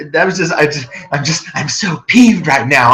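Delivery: ordinary talk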